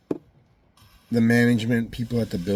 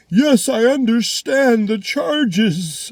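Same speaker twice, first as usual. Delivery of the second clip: very high, slow singsong parody; mock compliance; 11 semitones higher, 6 dB louder, much slower than usual. Defendant parodies the answer the court expects, mock compliance. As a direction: squeal it slowly like an obedient simpleton.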